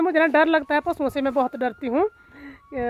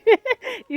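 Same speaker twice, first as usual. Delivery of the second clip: high amused giggle; 8 semitones higher, 3 dB louder than usual. Real, not acted. Laughs at buffalo's thoughts.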